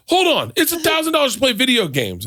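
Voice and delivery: high-pitched mock exasperation; retold exasperation. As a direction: imitate a caller protesting in disbelief. Retold caller protests in disbelief.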